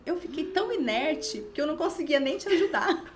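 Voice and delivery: high, loud, laughing mock dismay; retold shock. Friend confessing to young mother she froze, mock shock. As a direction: protest helplessness in comic disbelief.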